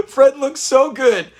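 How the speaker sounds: real laughter at Fred's outfit; laughing. Real, not acted. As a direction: laugh the line out.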